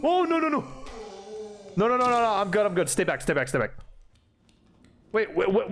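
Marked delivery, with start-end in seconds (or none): 0.0-2.3 high, rapid-fire panic; real gamer panic, pitched up; panicked. Real, not acted. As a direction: yelp in alarm as danger closes in. Panics as danger appears in game.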